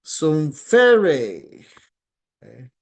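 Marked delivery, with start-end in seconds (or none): 0.0-1.6 "Sumfere" loud, pitch raised on "fe"; emphatic lifted accent in the middle of "Sumfere"; acute accent. Shown as a demonstration. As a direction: punch "fe" up in "Sumfere". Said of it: "Have an acute".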